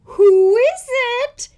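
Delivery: high, loud fake old lady; wolf trilling as sweet Granny. Wolf in bed, sweetest granny voice. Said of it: "in his sweetest granny voice".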